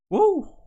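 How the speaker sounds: loud, high-pitched "woo"; excited whoop. Real, not acted. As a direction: let out a sudden high cheer. Cheering the idea of an acapella.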